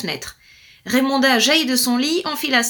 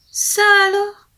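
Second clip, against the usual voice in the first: high, louder exclamation; surprised; 9 semitones higher, 5 dB louder than usual. A narrator's reading. Storyteller surprised to find the wolf. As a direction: gasp it out in surprise.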